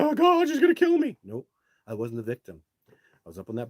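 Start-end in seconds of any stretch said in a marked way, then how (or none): 0.0-1.2 hammed-up terrified cry, much higher and louder; mock panic. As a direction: play a mock victim shrieking in fear. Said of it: "I wasn't there going".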